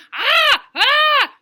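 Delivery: loud high shouting clown; screaming. Acted. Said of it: "I kept on screaming".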